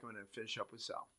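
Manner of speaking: normal talking voice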